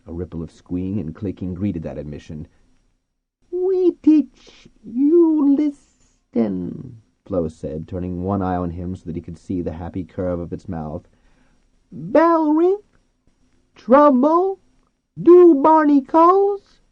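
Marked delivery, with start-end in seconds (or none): 3.5-7.0 very high, loud, drawn-out squeal; talking dolphin. A narrator's reading. Dolphin Flo speaking. 11.9-16.8 very high, loud dolphin squeal, rising; questioning dolphin. A narrator's reading. Dolphin Flo asking questions.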